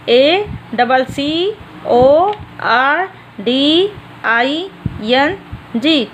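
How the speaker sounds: each letter drawn out slowly; slow spelling. Shown as a demonstration. Teacher dictating a spelling to young students, slow and deliberate. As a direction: spell it out one letter at a time for the class. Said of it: "according ऐसे right किया जाता है बेटा".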